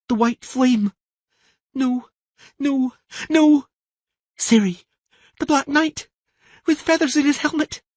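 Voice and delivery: put-on high girl's voice, rising to a cry; a child reliving a terror; frightened girl in a trance. Siri in a trance crying out her fear to Tris.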